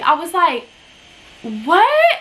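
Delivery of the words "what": re-enacted bewildered "what?", sharply rising; baffled disbelief. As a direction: stretch it upward, pausing in mock disbelief. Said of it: "I was so confused".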